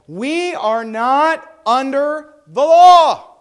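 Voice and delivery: slow, loud, every word weighed, "law" falling; emphatic, slow. Shown as a demonstration. Teacher insisting to class on freedom from law, slow emphatic stress. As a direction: drive it home slowly. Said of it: "It's very emphatic".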